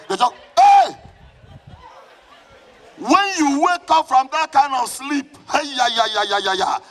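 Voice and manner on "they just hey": a sudden high cry of alarm, much louder; startled yell. Retold or imitated. Congregant retelling a night attack, yelling in alarm. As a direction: burst out with a startled hey.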